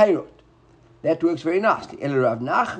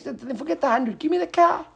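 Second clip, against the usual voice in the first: seller voice jumping high on the demand; acted seller demanding; 10 semitones higher than usual. Seller insists the buyer hand over the cow, demanding.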